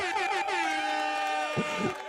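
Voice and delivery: high, long helpless laugh; laughing hard. Real, not acted. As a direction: lose it at the joke. Host cracks up at demon meats.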